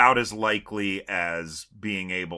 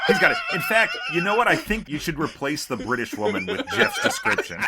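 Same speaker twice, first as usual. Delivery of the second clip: laughing; 8 semitones higher than usual. Real, not acted. Laughing at Jeff's explanation.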